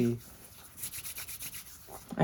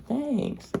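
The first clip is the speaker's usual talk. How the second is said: much higher, squeaky Thanks; meme squeaky thanks; 12 semitones higher than usual. Retold or imitated. Quoting the meme video, high squeaky Thanks. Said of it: "I literally thanked the guy".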